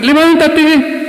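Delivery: gritado, mucho más agudo y fuerte; anímalo como multitud en la arena; gritos del público. Retold or imitated. (cinco mil hombres le gritan al caído, fuerte)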